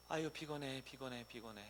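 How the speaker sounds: soft, tired complaint under the breath; weary grumble. Acted. Preacher mimicking congregants complaining at prayer, weary grumble. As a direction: grumble quietly, imitating a worn-out worshipper.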